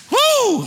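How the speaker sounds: a sudden high whoop of joy; high joyful woo. Real, not acted. Preacher whoops high to the congregation in joy. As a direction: let out a high woo of praise.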